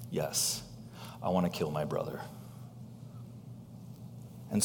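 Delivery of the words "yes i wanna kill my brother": much lower and softer, a sinister hint; low, quiet and coaxing, lightly voiced; voice of sin. Preacher giving sin's reply to Cain, low and soft. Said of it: "Sin, on the other hand".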